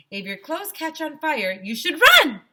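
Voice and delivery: high, loud confident guess; eager kid answer. A narrator's reading. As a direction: sure of himself, shouting the answer. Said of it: "I said".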